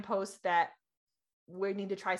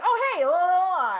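high, loud, sing-song burst; mock-excited blurting over someone, much higher and louder; bubbly imitation of barging in; excited blurting interruption; 8 semitones higher, 10 dB louder than usual. Acts out blurting at husband.

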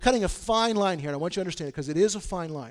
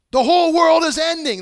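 much higher, loud frantic shout; panicked cry; 11 semitones higher, 12 dB louder than usual. Acted. Ancient people crying out that the world ends, mock panic. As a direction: yell it like the sky is falling.

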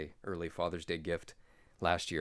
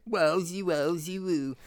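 high, loud goofy exclamation; cartoonish catchphrase; 8 semitones higher, 5 dB louder, slower than usual. Guest clowning along with the host's joke, high goofy voice. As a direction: toss out a silly raised catchphrase. Catchphrase imitation after the teasing wah.

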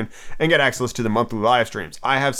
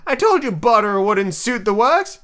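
angrily; 8 semitones higher, 3 dB louder, slower than usual. A narrator's reading. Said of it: "he added angrily".

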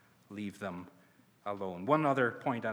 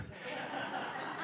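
laughing; 11 dB quieter than usual. Real, not acted. Laughs at own impression.

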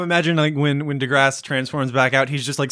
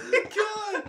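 after laughing hard; 8 dB quieter than usual. Real, not acted.

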